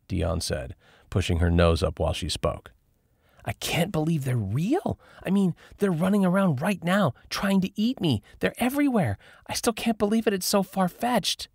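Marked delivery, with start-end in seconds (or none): 3.4-11.5 put-on high, breathless ranting girl. A narrator's reading. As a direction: rant it out in a high voice. Lauren rants, high voice.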